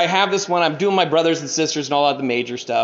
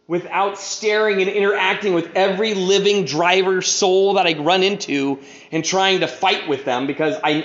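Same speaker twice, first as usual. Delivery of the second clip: ordinary delivery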